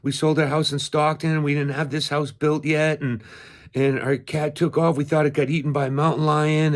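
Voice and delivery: high-pitched, run-on retelling of upset neighbors; imitated tearful outpouring. Neighbors explaining how they lost their cat, imitated tearful outpouring. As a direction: pour it out high, mimicking overwhelmed owners. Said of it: "they started crying".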